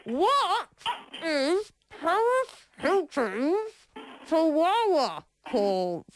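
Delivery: slow careful words with pauses; slow and deliberate. Shown as a demonstration. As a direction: spell it out slowly, word by word. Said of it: "Go again, ask her again".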